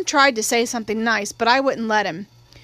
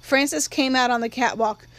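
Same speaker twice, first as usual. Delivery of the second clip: ordinary delivery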